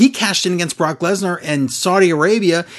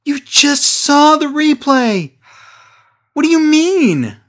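exasperated; 9 semitones higher, 3 dB louder than usual. Real, not acted. Exasperated reply to Tom.

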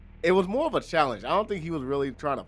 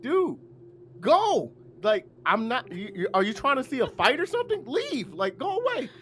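high-pitched retold demand to be left alone; exasperated yelling; 9 semitones higher than usual. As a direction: shoo the officer off, voice pitched way up. Continues retold yelling at cop.

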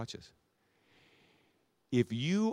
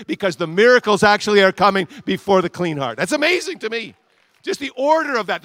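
preaching at full force; 6 semitones higher, 11 dB louder than usual. Real, not acted. Preacher building to climax.

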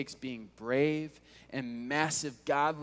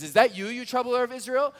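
accusing king; 9 semitones higher, 7 dB louder than usual. Acted. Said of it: "Ahab says".

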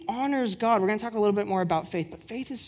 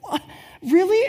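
retold amazement at a healing; shocked; 9 semitones higher, 6 dB louder than usual. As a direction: gasp it out in disbelief. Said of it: "I was way more shocked".

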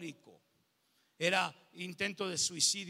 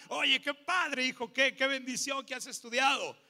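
higher and louder, the enthusiastic praise he wished for; hoped-for proud praise; 6 semitones higher, 4 dB louder than usual. Pretend. Pastor voicing the praise he wanted from his father, excited. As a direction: gush with pride over his son. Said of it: "Yo esperaba que me dijera".

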